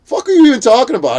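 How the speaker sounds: high, loud, fast acted outrage; retold indignant challenge. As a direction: fire it off in mock disbelief. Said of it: "and I'm like".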